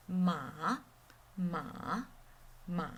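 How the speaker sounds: MA dipping low, then rising; third tone. Shown as a demonstration. Teacher drills tone three, falling then rising. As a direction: drop "ma" low and swing it up. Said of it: "And the third tone".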